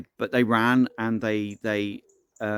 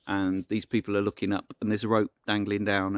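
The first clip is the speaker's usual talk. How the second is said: ordinary delivery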